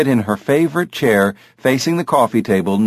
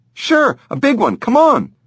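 put-on much higher eager kid voice; excited boy; 13 semitones higher than usual. Jamie leads Dave outside, higher excited boy voice. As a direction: kid rushing out to play.

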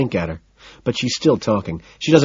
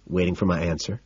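plain reading voice

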